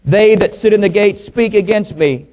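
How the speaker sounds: projected, measured pulpit reading; scripture reading. Real, not acted. Preacher reading psalm to congregation, scripture reading. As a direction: proclaim it verse by verse.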